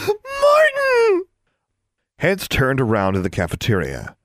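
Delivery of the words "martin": shrill-pitched squeal of a name, very high and loud; high squealing scream. Acted. Girl screaming across the cafeteria at her crush, ecstatic squeal. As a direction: scream his name in delight. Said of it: "she practically screamed".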